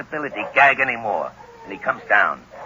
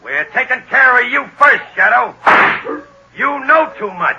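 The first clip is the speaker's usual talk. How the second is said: angry shout; 10 semitones higher, 6 dB louder than usual. Acted. Gunman threatens the treed Shadow.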